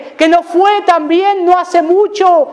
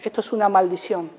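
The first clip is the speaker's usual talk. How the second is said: "Esto es una maldición" repeated much softer and quicker; soft quick echo; 7 semitones lower, 10 dB quieter, faster than usual. Real, not acted. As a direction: drop to a near-hush on "Esto es una maldición". Eco de la frase. (eco suave y rápido de "Esto es una maldición")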